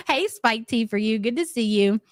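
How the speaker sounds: usual speaking voice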